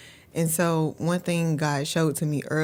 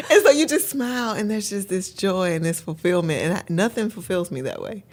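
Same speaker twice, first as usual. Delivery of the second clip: ordinary talk, nothing marked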